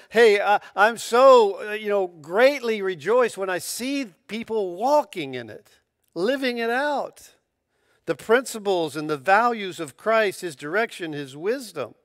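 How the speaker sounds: loud, high, joyful proclaiming; emphatic rejoicing. Retold or imitated. John rejoicing over believers, loud emphatic joy. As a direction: burst out glad and emphatic, then settle. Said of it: "John was being very emphatic".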